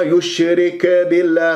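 wrong يشرك with an echoing bounce on the k; bounced kaf. Shown as a demonstration. Teacher mimicking a common error, bounced kaf in يشرك. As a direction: add a little vowel after the k of يشرك. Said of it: "قلقلها البعض".

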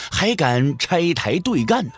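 ordinary delivery

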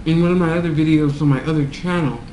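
ordinary talk

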